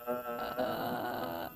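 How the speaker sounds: loose low creak; fry with a relaxed larynx; relaxed fry demo. Shown as a demonstration. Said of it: "squeeze a little bit the larynx".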